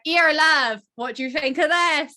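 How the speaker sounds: mock a friendly Somerset shop lady with a hint of Bristolian; a Somerset lady's Bristolian accent, lightly imitated. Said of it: "Southwest Bristolian Somerset lady with a little accent".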